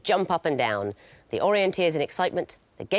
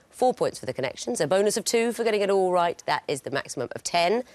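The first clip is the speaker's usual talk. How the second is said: ordinary delivery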